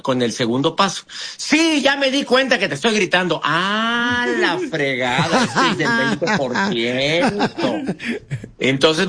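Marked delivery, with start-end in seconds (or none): 1.4-3.4 defiant shouter. Acted. Shouter's defiant reply, role-played. 3.4-7.9 laughing. Real, not acted. Laughing aside about stubborn shouter.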